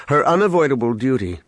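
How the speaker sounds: plain reading voice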